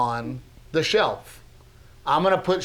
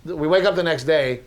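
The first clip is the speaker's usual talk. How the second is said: normal talking voice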